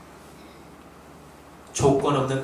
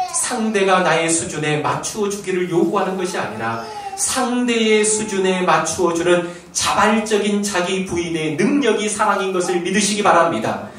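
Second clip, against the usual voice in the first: ordinary delivery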